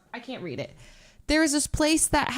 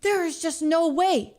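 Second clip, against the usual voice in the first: incredulous; 6 semitones higher, slower than usual. Retold or imitated. Her reaction in the scene.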